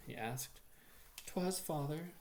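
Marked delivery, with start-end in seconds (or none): 1.3-2.1 with a sob. A narrator's reading. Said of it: "said I with a sob".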